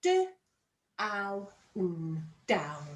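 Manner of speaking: D, ow, n each sound apart, then blend into down. Shown as a demonstration. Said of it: "Sound out down".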